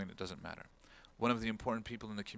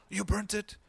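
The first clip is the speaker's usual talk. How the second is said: high, loud shocked question; can't believe what he's hearing; upset disbelief; 10 semitones higher, 5 dB louder than usual. Retold or imitated. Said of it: "He got upset at me".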